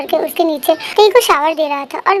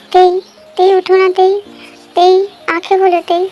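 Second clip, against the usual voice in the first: louder, urgent calling to wake her; 2 semitones higher, 4 dB louder than usual. Acted. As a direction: call her name to rouse her.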